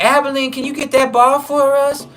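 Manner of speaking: high, slow, sweetly flirty falsetto; flamboyant ask. Retold or imitated. Inmates ask narrator to fetch the ball, sweet high falsetto. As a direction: bat your lashes and ask sweetly. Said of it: "They'll be like".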